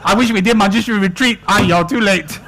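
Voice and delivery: a joking put-on parent voice, laughing through it; mock regretful parent. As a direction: tease like a parent sighing over a late regret. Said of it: "sometimes I look at you and say".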